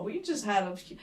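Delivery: ordinary talk, nothing marked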